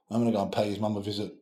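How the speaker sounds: ordinary talk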